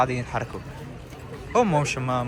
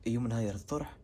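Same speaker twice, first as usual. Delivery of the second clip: hushed voice; 5 semitones lower, 13 dB quieter than usual. Real, not acted. Early morning, people still sleeping.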